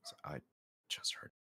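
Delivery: barely voiced, hushed; secretive whisper to the party. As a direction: drop right down to a whisper. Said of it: "I'm whispering this, by the way".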